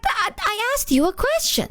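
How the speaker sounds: high, drawn-out mock complaint; slow high-voiced objection; exasperated protest. Retold protest at vague answer.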